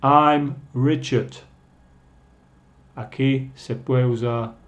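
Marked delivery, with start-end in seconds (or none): 0.0-1.4 slow, clearly projected phrases for learners; teacher's drawn-out demonstration; slower model reading. Shown as a demonstration. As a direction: say it slowly for students to repeat. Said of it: "voy a decirlos más lento".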